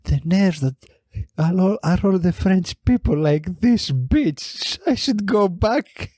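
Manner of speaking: a snooty huff about the French; mimicked huffy woman. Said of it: "she goes like".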